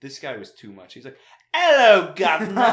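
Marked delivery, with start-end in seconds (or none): 1.5-2.7 high, loud mock Cockney greeting; fake British accent. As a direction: ham up a bad British accent. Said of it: "his fake British accent".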